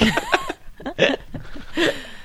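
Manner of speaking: high-pitched burst of laughter. Real, not acted. Laughing at his own porch joke.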